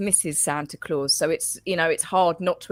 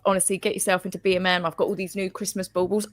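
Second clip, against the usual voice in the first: ordinary talk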